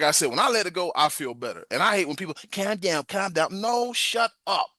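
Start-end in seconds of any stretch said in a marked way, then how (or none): 2.5-3.6 mocking calm down. Mimicking people saying calm down. 3.6-4.7 high, slow mock retort; hammed-up snap at people saying calm down; mock snap back.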